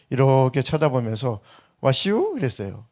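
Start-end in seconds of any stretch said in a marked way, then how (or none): 1.8-2.4 "와시오?" stretched out in a slow Chungcheong country drawl; an old country barber mumbling "와시오?" at the customer; lightly voiced Chungcheong barber. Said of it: "충청도 시골 동네 이발사".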